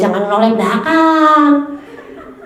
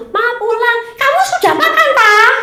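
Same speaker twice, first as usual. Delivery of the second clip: very high mock mum asking about dinner; mother's voice; 12 semitones higher than usual. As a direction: squeak it out like a fussing mother, laughing. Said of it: "suaranya gitu toh".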